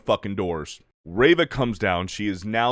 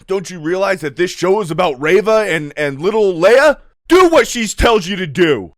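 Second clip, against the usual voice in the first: loud, high mock outrage; hammed-up angry hero's rant; pissed; 6 semitones higher, 8 dB louder than usual. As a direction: scold them in an overblown, indignant shout.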